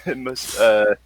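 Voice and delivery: normal talking voice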